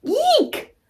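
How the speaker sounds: very high little fright squeal; mock scared squeal. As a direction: squeal like you saw a monster. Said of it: "When I see something scary".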